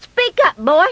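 high, sharp demand; acted impatient scolding; exasperated mother. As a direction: order the boy to talk. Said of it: "She was just at her wits' end".

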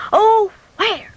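high, distressed mock wail; frantic searching. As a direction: cry out like a frantic mother. Voices frantic mother searching.

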